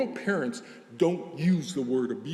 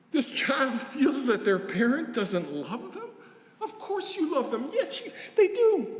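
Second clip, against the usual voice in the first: incredulous laugh; 11 semitones higher than usual. Real, not acted. Disbelief that child feels unloved.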